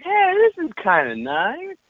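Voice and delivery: very high, drawn-out approval; retold pleased surprise. Audience seeing the San Francisco shots, pleasantly surprised.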